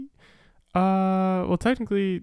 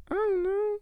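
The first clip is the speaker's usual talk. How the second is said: high squeaky voice. Real, not acted. Shy falsetto answer.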